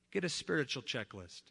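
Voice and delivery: normal talking voice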